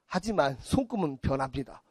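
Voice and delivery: low, soft solemn caveat; mock palm reader. Palm reader closing the reading, low solemn disclaimer. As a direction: drop into a hushed wise disclaimer.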